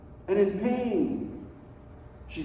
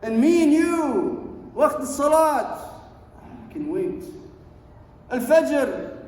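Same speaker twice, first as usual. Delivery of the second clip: higher and louder, slow stern preaching; rebuking sermon; 7 semitones higher, 4 dB louder than usual. Acted. Preacher rebuking the congregation for delayed prayer, stern loud preaching. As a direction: scold the listeners for missing prayer, drawn out.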